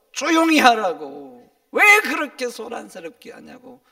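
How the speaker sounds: retold scolding. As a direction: scold like the crowd silencing someone. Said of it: "오히려 야단을 치는 거예요".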